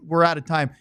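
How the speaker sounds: normal talking voice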